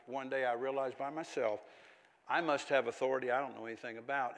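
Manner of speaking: normal talking voice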